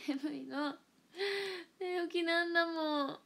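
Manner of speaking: high, drawn-out whine; pouty, stretched-out complaint; whiny protest. Real, not acted. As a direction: plead your excuse in a loud, slow wail. Whiny excuse for being off form.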